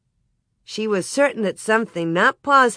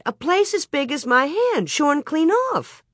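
shocked; 9 semitones higher than usual. A narrator's reading. Ma alarmed interrupting.

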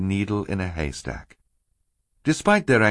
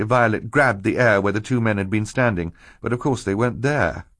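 ordinary delivery, nothing marked

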